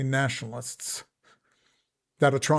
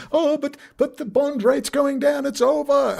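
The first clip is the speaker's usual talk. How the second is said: much higher, slow whiny mock alarm; mock panic; 11 semitones higher, 4 dB louder than usual. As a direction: parody the worried investor crying doom. Mocking doom-saying investors.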